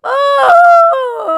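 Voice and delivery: long mournful wail. Acted. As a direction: wail in despair. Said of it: "let out a mournful wail".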